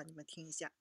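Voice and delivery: ordinary talk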